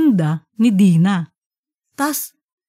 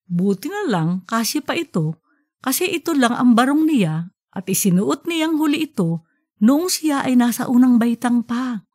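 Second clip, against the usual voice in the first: ordinary delivery, nothing marked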